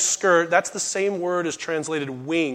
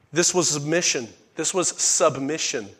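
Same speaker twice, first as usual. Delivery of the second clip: usual speaking voice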